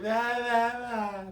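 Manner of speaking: loud high wild yelps; mock crazed shouts from a man swinging on the bar; mad yelling noise. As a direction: let out a crazy stoned howl. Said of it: "making this mad noise".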